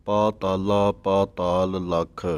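ਪਾਤਾਲਾ ਪਾਤਾਲ slowly, with full long aa in every kanna; careful model reading of ਪਾਤਾਲਾ with long vowels; long vowels sounded. Shown as a demonstration. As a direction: draw out each long aa in ਪਾਤਾਲਾ. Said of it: "ਇਹਨਾਂ ਤਿੰਨਾਂ ਕੰਨਿਆਂ ਨੂੰ ਬੋਲਣਾ ਹੈ".

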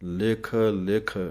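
"ਲਿਖ ਲਿਖ" said slowly as two separate words with a gap; "ਲਿਖ ਲਿਖ" slow, each ਲਿਖ apart; each word separately. Shown as a demonstration. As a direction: split "ਲਿਖ ਲਿਖ" cleanly, one word at a time. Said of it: "ਵੱਖਰਾ ਵੱਖਰਾ ਪੜ੍ਹਨਾ ਹੈ".

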